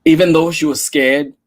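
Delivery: ordinary delivery, nothing marked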